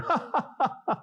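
high-pitched staccato fake laugh; mocking laugh. Coworkers laughing at the Bible reader, mocking cackle. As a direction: put on a jeering ha-ha-ha. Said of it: "coworkers that are mocking you".

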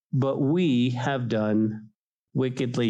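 "we" leaned on, very slow; slow down and weigh "we"; stress on we. Shown as a demonstration. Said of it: "underline the word "we".

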